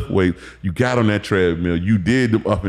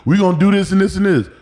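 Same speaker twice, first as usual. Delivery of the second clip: high, louder mock coach voice; mimicking the coach; 11 semitones higher, 4 dB louder, faster than usual. Imitating his strength coach listing drills, pitched high. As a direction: rattle off the coach's plan. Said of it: "conditioning coach".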